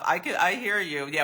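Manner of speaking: usual speaking voice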